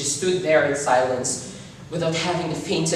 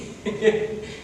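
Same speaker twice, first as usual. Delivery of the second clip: breathy laugh; 4 semitones higher, 3 dB quieter than usual. Acted. Opening manic laughter.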